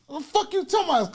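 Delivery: usual speaking voice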